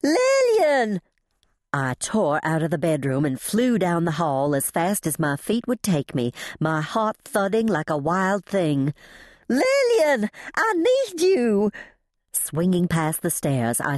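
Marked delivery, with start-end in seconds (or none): 0.0-1.0 high, drawn-out panicked yell; urgent holler, pitched way up; frantic calling out. A narrator's reading. Frantic calling through the house. 9.5-11.7 high, drawn-out cry for help; panicked, slow-stretched high call; frantic calling out. A narrator's reading. Frantic calling through the house.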